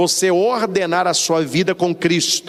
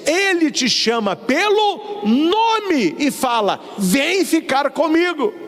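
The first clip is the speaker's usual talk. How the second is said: high, drawn-out sermon climax; impassioned preaching; 8 semitones higher, slower than usual. Real, not acted. Preacher telling the flock Jesus calls them, impassioned. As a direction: lift the pitch and stretch the call.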